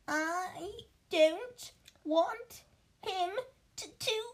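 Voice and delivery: hammed-up crying brat, slow and high; fake sobbing. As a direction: whine it like a spoiled boy faking tears. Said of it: "between huge pretend sobs".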